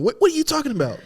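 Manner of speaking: high, loud outraged boss; mock indignant boss. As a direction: play the offended owner. Pretend boss scolding worker.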